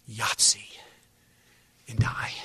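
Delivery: low, feeble last word; dying hoarse whisper. Acted. Dying father giving last word to hushed family, hoarsely. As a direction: croak it out as a dying man. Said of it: "I'm now hoarse because I'm dying".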